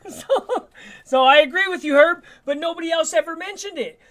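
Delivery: chuckling through the words; laughing. Real, not acted. Laughs while agreeing with Herb.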